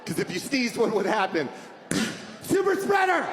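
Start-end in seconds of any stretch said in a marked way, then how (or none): 2.4-3.2 mock shout. Accusing a sneezer in public.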